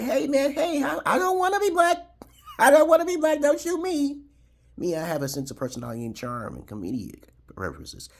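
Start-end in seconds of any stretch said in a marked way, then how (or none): 0.0-4.2 very high, loud squealing plea with laughs; mock panicked plea. Staring down shotguns, he squeals a hammed-up plea. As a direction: beg for your life, hammed-up. Pleading for his life, laughing.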